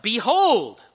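loud, high, commanding "Behold"; urgent exclamation. Acted. Preacher showing the congregation the force of the word, urgent exclamation. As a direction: cry it out so everyone turns to look. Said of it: "this is look at it".

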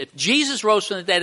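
ordinary talk, nothing marked

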